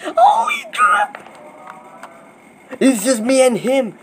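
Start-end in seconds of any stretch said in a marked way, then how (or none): 0.0-1.2 high, slow, strained shout of disbelief; excited shriek. Real, not acted. Gamer shrieking as the win gets close. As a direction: cry it out in real shock. Tense final moments of Skywars.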